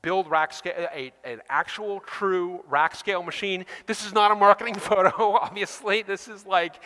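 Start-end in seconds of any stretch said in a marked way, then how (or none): none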